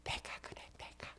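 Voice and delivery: breathy whispered aside imitating a church elder; mimicking a whisper. Pastor teasing a deaconess who whispers back, hushed mock whisper. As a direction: whisper it as if muttering in the pew. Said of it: "어떤 권사님은 지금".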